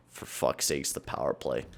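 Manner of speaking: low, very soft, breathy curse; mutter. Real, not acted. Gamer muttering to himself about the power play, very quiet. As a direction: grumble it under the breath.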